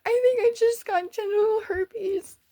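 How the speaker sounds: high, hammed-up tearful confession; mock tearful. Confessing to dad back home, mock tearful wail. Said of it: "I got tears on my face".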